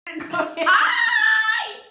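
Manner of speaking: high, loud drawn-out squeal; excited squeal. Real, not acted. Vlogger greeting her viewers, excited high squeal. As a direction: let out a long, piercing happy squeal.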